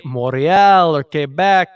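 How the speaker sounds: drawn-out, French-accented place names. Shown as a demonstration. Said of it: "say it in a French accent".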